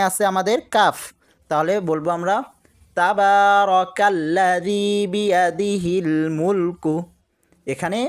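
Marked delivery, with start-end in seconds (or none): 3.0-6.9 slow, drawn-out chanted recitation of the verse; slow Quran recitation. Shown as a demonstration. Teacher reciting the verse for students, slow and chanted. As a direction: recite it slowly, drawing out each vowel.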